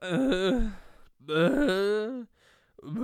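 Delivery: loud, high wordless wails; drawn-out panicked groans, imitating yourself; horrified noise. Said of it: "I made a noise. I went".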